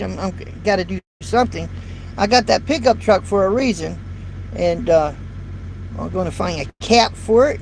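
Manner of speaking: normal talking voice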